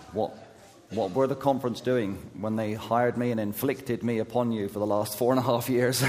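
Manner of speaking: usual speaking voice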